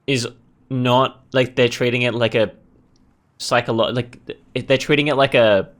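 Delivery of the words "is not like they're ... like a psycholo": usual speaking voice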